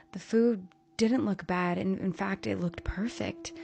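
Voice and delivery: ordinary delivery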